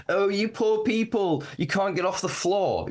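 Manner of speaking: high, slow, pitying upper-class voice; mock posh liberal. Mocking the upper-class liberal to his cohost, high and pitying. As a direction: sneer it out like a condescending toff. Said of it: "that quintessential upper-class liberal".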